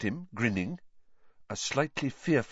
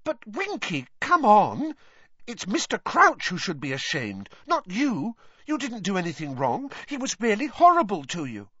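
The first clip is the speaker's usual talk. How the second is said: lightly voiced girl; 11 semitones higher, 3 dB louder than usual. Hermione's voice.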